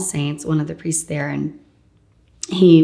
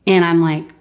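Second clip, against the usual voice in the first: normal talking voice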